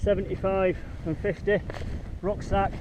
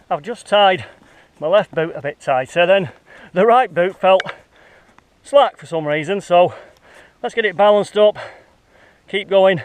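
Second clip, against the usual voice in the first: breathless from the steep climb; puffing while walking uphill; out of breath; 3 semitones higher, 7 dB louder than usual. Real, not acted. Climbing steeply with heavy rucksack.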